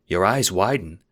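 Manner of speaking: ordinary delivery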